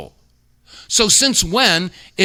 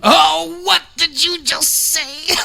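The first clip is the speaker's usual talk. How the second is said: high-pitched, drawn-out mock disbelief; mock shock; 12 semitones higher, slower than usual. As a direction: squeal it slowly, as if stunned by what you heard. Mock shock at a played clip.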